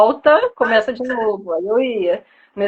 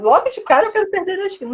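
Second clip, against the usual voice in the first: eager self-quote; 8 semitones higher, faster than usual. Retold or imitated. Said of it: "Eu falava".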